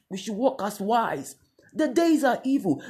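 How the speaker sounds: normal talking voice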